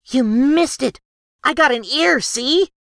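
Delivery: high, loud, delighted gnome bragging; gleeful gnome girl. A narrator's reading. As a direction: show off the prize, thrilled and high. Gnome proudly showing a trophy.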